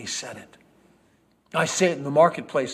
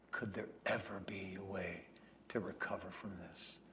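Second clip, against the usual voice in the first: grieving whisper; 7 semitones lower, 20 dB quieter than usual. Acted. Grieving at the cross.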